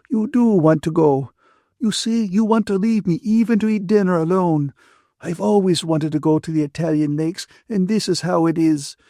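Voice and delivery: high-pitched, wounded complaint; self-pitying Ferguson. A narrator's reading. Ferguson accuses them of leaving her to dine alone, wounded. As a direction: complain as the abandoned friend, near tears.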